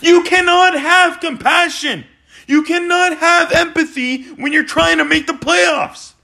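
high, insistent exasperated yelling; real screaming rant. Real, not acted. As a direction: yell it as an outraged fan. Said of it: "I'm screaming. I'm yelling".

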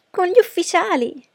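high, loud, thrilled echo; exclaiming. A narrator's reading. Daughter hearing of dinner with the officers, exclaiming. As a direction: cry it out in excited astonishment. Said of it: "esclamò Lydia".

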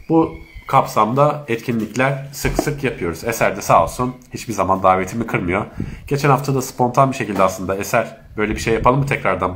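ordinary talk

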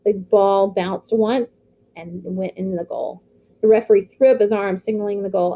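plain reading voice

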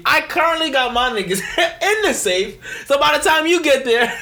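high, loud, laughing brag; laughing boast. Acted. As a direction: crow it out through laughter. Gleeful bank robbing boast.

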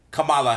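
Kamala with stress on the middle syllable; unsure pronunciation of Kamala. Real, not acted. Host introducing the vice president, Kamala said kuh-MAH-luh. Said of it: "Is that how you say it?".

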